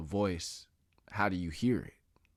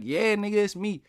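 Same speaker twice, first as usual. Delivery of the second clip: much higher, louder imagined grandma; mock grandma reply; 13 semitones higher, 9 dB louder than usual. Imagined grandma answers from beyond death, high excited shout. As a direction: pretend to be grandma answering back emphatically. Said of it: "she might be like".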